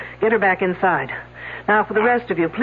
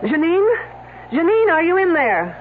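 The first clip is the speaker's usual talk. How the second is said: raised voice calling her name; 9 semitones higher than usual. Acted. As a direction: call through the closed door. Calling out to locked-in woman.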